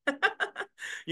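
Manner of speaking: high airy giggle; light real giggle; breathy giggle. Real, not acted. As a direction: giggle in disbelief. Laughing at the critic.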